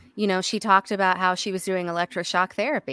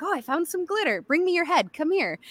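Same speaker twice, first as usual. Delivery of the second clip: playful Carrie Fisher; 9 semitones higher than usual. Retold or imitated. Voicing Carrie Fisher with glitter.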